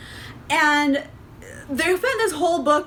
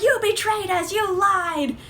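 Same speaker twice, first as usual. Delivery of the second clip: higher-pitched imitation of outraged teens; mock the squad's hurt accusation; mock betrayal; 6 semitones higher than usual. Squad turning on their crewmate, lightly mocking high whine. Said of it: "and they're like".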